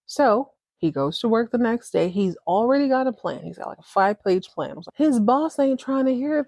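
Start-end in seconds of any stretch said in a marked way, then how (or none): none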